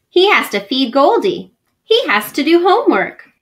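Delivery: ordinary delivery, nothing marked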